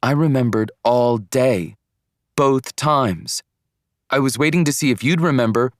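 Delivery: indignant. A narrator's reading. Girlfriend tells boyfriend she waited all day, indignant. Girlfriend argues back, hurt and accusing.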